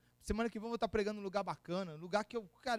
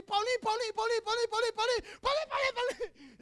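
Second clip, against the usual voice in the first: high, loud repeated calling of a name; a sister yelling for her brother, much higher and louder; frantic calling; 13 semitones higher, 7 dB louder than usual. Retold or imitated. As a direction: call him urgently from up the street. Sister calling him from the street.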